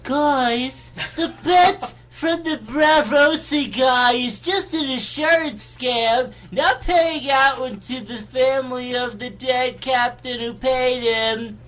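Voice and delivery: high, slow elf voice; drawn-out high fairy-folk voice. Acted. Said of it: "That's my elf voice".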